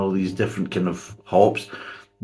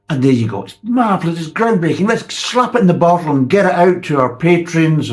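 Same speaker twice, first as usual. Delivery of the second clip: hammed-up self-praise, louder and higher; mock brewer gushing; 6 semitones higher, 5 dB louder than usual. Reviewer mocking brewers praising their own beer, hammed-up glee. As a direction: gush over the hops like a smug brewer.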